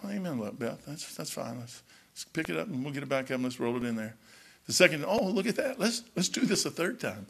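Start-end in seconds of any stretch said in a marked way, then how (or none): 0.1-4.2 soft, mild, patient voice; hushed, easygoing calm; calm patient reply. Pretend. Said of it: "If I'd have just sit there yesterday and said". 5.1-7.2 soft, amused, patient voice; quiet easygoing reply; mock calm patience. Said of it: "If I'd have just sit there yesterday and said".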